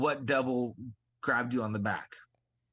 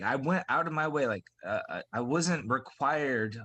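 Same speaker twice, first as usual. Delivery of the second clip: ordinary talk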